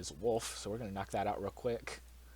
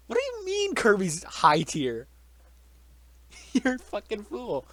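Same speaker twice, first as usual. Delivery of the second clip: higher, louder, slow, laughing mock fury; mock outrage; 10 semitones higher, 8 dB louder, slower than usual. Real, not acted. Teasing a friend over Kirby in high tier, loud laughing mock rant. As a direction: draw it out, half laughing at the friend.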